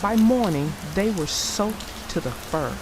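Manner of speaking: lively read-aloud storyteller voice; story narration. A narrator's reading. Narrator reading the Bear family story aloud to kids. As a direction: tell it like a bedtime picture book.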